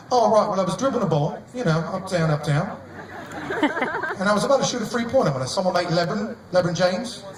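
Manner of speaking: mock British athlete at a press conference; lightly done British accent. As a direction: ham up a chatty English bloke. British basketball player impression.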